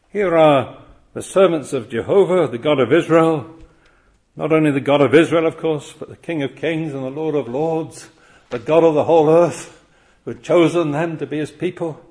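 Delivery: fervent preaching. Acted. Preaching at full force.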